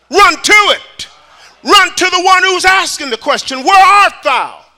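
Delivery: high, loud, shouting sermon climax; preaching at full force. Acted. Preacher urging the church to run to God, shouting. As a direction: drive it home to the congregation.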